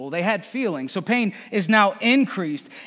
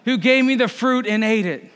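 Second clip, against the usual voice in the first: higher, louder whiny protest; Adam shifting blame; 5 semitones higher, 5 dB louder than usual. Acted. Adam answering God, whining loud and high. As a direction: push the blame away like a caught kid. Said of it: "The man replied".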